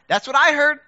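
usual speaking voice

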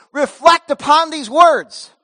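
loud, slow royal proclamation; mock king's decree. King of Nineveh mocked, loud slow decree. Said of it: "The king does not make a decree".

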